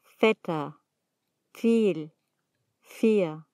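Feta, Viel, Vier opened with a voiceless "f". Shown as a demonstration. Teacher showing one of the two V sounds, "f" onset in Feta, Viel, Vier. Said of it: "Puede sonar como F".

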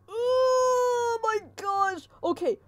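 very high, long-drawn cry; panicked wail. Real, not acted. Player fleeing the chaser, panicked wail. Startled while fleeing in game.

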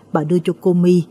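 ordinary delivery, nothing marked

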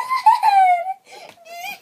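high, loud shrieking laugh; squealing laugh. Real, not acted. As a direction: burst into a delighted squeal. Squeals while dancing.

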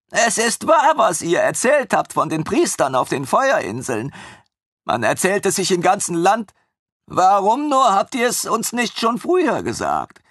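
high, gushing voice of the eager servant; devoted young servant. A narrator's reading. Armin gushes to his former master about his happy lioness, high and eager.

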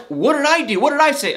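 high, baffled questions, playing dumb; mock clueless innocence. Mother-in-law acting clueless after the fight, high innocent questions. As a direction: act like you have no idea what you did. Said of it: "But Trish was still like".

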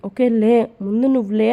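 ordinary delivery, nothing marked

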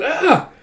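short, high startled "Ah!"; sudden mock shriek of surprise; freaked-out yelp. Princess startled by him coming up beside her, freaked-out yelp. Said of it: "she freaks out with an".

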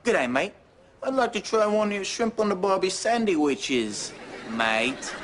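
put-on Australian accent, softly spoken. Shown as a demonstration. Offering a decoy voice for the mission, Australian accent. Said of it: "I'll speak with an Australian accent".

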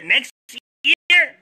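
the word year yelled high and loud; shouted vow. Real, not acted. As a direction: belt it out like a rally cry. Fan vowing a Super Bowl run.

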